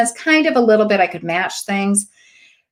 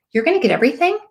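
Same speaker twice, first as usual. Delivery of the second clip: high, squealing mock delight; a giddy, overexcited rookie voice; over-eager gushing; 7 semitones higher, faster than usual. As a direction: gush like a nervous new seller. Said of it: "I was terrible".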